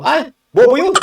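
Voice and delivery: high, loud, scoffing put-on voice of a passerby; mocking gossip. A bystander dismissing the senator, mocking and loud. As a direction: sneer it out like a gossip. Imitating what people will say.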